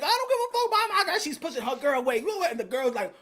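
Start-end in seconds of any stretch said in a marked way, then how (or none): 0.0-1.2 high, fast retort; rushed high imitation; mock defiant woman. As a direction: imitate a girlfriend in his face. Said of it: "she's still in his face like".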